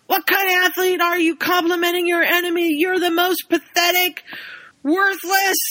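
high, furious ranting coach. Retold or imitated. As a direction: tear into the player in a rage. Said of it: "O'Malley just goes nuts".

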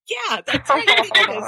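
"Nicholas" sung out high and loud, teasing; mocking kids. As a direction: tease him in a sing-song. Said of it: "And they'd just go".

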